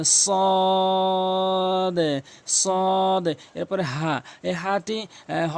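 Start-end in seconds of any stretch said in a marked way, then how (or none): 0.1-3.3 "সদ" with a long, rounded held vowel; drawn-out letter. Shown as a demonstration. Teacher demonstrating, long full-mouthed "সদ". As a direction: chant "সদ" slowly on one level note. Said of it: "সেই আগার সঙ্গে লাগাবেন".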